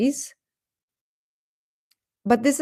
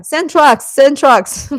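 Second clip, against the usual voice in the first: higher, louder mock chant of angry fans; mocking netizen demand; 6 semitones higher, 8 dB louder, slower than usual. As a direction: mimic fans demanding protest trucks. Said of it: "they would say".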